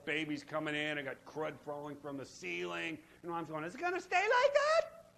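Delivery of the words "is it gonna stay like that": much higher, fretting; mock worried high voice. Worker fretting over the ceiling, mock high voice. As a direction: squeak the worry.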